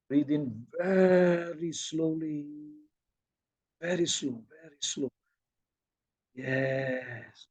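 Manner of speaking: hushed, long-stretched meditation cues; very slow breathing. Shown as a demonstration. Teacher bringing the group to eight-second breaths, very slow and soft. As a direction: soften right down and draw each breath out. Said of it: "bring them until eight seconds".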